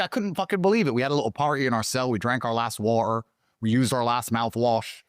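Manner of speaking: ordinary talk, nothing marked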